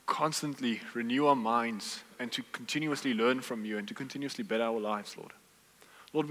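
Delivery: quiet pastoral prayer before the sermon. Real, not acted. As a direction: pray it humbly to the Lord. Pastor leads opening prayer.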